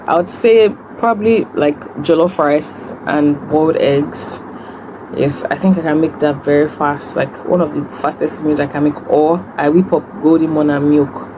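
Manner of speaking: ordinary talk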